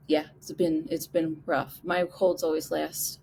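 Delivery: ordinary talk, nothing marked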